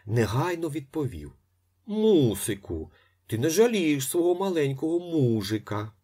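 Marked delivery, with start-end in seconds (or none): 1.8-5.9 a light hint of a baby voice; pouted-lips coaxing, lightly voiced; lips pouted, babyish. A narrator's reading. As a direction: whine like a little boy begging. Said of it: "згорнувши уже навиклі губи в трубочку".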